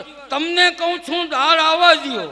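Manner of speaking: loud call. Acted. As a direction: demand it across the house. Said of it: "બીજી વાર".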